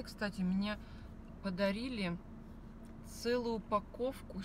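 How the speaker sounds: normal talking voice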